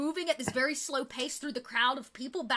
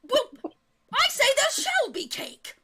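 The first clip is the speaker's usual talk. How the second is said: high, loud, pompous retort; defiant duke; 11 semitones higher, 5 dB louder than usual. Acted. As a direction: thunder it back at the rival. Duke shouting back at rival.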